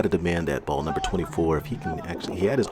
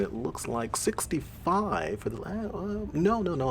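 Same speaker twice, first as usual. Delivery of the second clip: stumbling cold read; 9 semitones higher, 5 dB quieter, slower than usual. Real, not acted. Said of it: "I'm reading that wrong".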